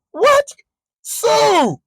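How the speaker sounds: very high, drawn-out exclamation; astonished greeting. Retold or imitated. Man recognizes a friend while stranded without gas, astonished greeting. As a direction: exclaim high, as if stunned to see them.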